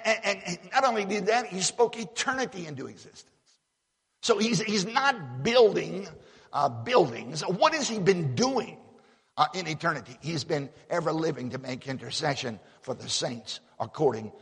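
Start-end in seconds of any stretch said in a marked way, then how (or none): 4.2-10.2 fervent preaching. Acted. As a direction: lean into each question from the pulpit. Preacher rhetorical questions, fervent.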